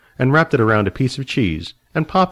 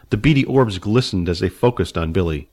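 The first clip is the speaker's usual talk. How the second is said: usual speaking voice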